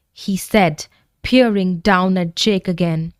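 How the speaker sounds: usual speaking voice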